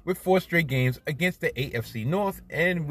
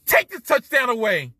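yelling at the TV in disbelief; outraged yell; 9 semitones higher, 7 dB louder than usual. Retold or imitated. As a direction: shout it high and loud, furious at the refs. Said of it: "and I'm like".

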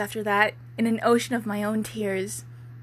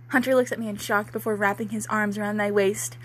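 ordinary talk

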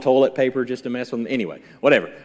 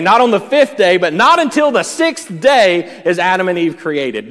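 higher, louder, building preach; preaching at full force; 5 semitones higher, 7 dB louder than usual. Real, not acted. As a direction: drive the point home. Preacher builds to emphatic climax.

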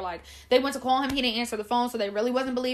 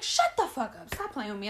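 retold disbelief; 3 dB quieter than usual. Friend refusing to believe pregnancy news, retold disbelief.

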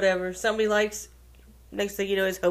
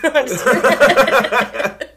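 laughing; 5 semitones higher, 8 dB louder, much slower than usual. Real, not acted. Laughing off a joke.

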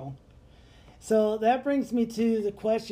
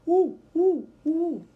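high, loud owl hoots; imitated owl call, each hoot falling; tipsy owl call; 8 semitones higher, 5 dB louder, slower than usual. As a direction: hoot back at an owl after a few beers. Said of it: "after a few Budweisers, I'm like".

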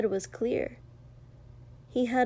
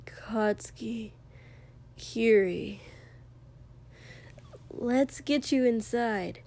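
quiet; slower than usual. A narrator's reading. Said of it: "the man said quietly".

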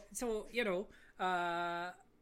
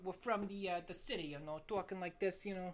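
put-on New York city talk; New York accent. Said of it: "I don't do the New York accent".

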